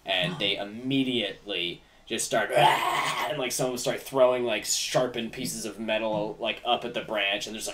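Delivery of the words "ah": sudden breathless goblin screech; goblin battle cry. Acted. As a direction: shriek like an ambushing goblin. Goblins attack the party.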